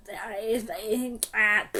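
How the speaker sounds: ordinary delivery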